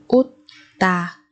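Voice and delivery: Utta split with a long gap; syllable by syllable. Shown as a demonstration. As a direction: pause between the syllables of Utta. Teacher splits word by syllable. (đọc Utta tách từng âm tiết)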